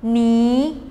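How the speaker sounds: "Ni" held level; first tone. Shown as a demonstration. Teacher practising syllables in four tones, "Ni" in level tone. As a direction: keep "Ni" steady on one pitch. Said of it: "in each of the four".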